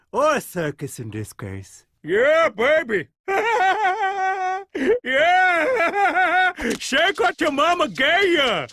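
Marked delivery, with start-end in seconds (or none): 2.0-8.7 party cheer. Acted. Party king cheering crowd.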